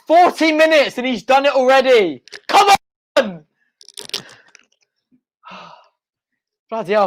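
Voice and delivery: usual speaking voice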